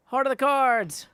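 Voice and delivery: loud, high, drawn-out shout of hope; hammed-up rallying cry. Gamer betting on a random boon, shouting with hope. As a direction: belt it like an anime duelist. Gamer calls out before a gamble.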